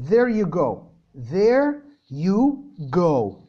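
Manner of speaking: drawn-out slow there you go; slow demo. Shown as a demonstration. Teacher drawing out "There you go" slowly for students. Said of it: "هدوكوا examples منها".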